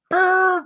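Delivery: high trilled brr; high, playful party-horn trill; mock party noise. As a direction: blow it like a noisemaker.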